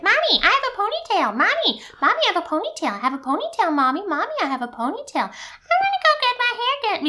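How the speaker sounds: put-on very high, fast kid chatter; chattering toddler. Mom imitating her daughter's nonstop chatter, very high. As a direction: repeat it like an excited little girl. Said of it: "it'll be like".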